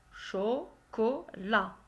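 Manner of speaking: CHOCOLAT slowly split as cho, co, la; syllable by syllable. Shown as a demonstration. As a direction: break "chocolat" into three drawn-out, separate syllables. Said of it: "la syllabe où tu entends".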